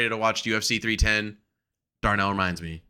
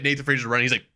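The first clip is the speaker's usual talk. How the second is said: usual speaking voice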